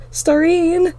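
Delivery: high, loud panicked scream of her name; desperate yell. A narrator's reading. Girl screaming for her sister amid haunting whispers. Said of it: "I yell".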